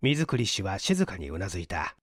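ordinary delivery, nothing marked

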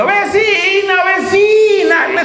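llamada a gritos, muy aguda y fuerte; grito a las vecinas. Acted. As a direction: grita a la vecina con la voz temblando. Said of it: "es a grito herido". (la mujer llama a gritos a las vecinas, voz aguda y temblorosa)

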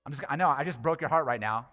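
ordinary talk, nothing marked

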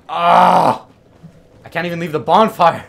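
loud, high, rushed complaint; exasperated yell. Real, not acted. Gamer trapped at the bonfire, exasperated yell. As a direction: yell it in frustration.

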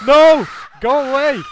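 high, loud panicked shout; wrzask. Real, not acted. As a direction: yell to drive an attacker off. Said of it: "you're obnoxious".

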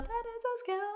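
"That is a skill" squeaked high and soft, falling at the end; squeaky high voice. Shown as a demonstration. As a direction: pitch "That is a skill" way up, then let it drop. Repeats the phrase with varied emphasis.

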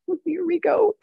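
skeptical aside. Retold or imitated. Skeptical inner aside, retold.

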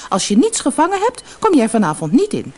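high, loud cross warning; grumpy wife. A narrator's reading. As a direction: scold sharply as a fed-up wife. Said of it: "zei de vissersvrouw knorrig".

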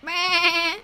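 imitated high-pitched bleat; mock sheep noise; lamb bleat. Imitates the lamb she spotted.

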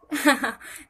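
short high, loud laugh; loud burst of laughter. Real, not acted. Laughing at chat comments.

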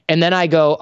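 usual speaking voice

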